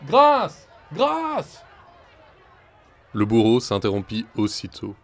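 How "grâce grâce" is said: very high, loud cry of grâce. A narrator's reading. A voice from the carriage, loud high cry. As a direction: call out for mercy from afar. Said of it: "une voix criait".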